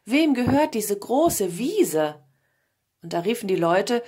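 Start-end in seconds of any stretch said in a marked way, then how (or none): 0.0-2.2 loud, slow, drawn-out hail; calling out. A narrator's reading. King asks people who owns the meadow, calling out. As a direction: call slowly across the meadow. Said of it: "ließ anhalten und rief".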